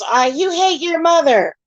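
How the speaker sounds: high, sneering mock of the accusers; mocking the haters. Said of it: "Now it's".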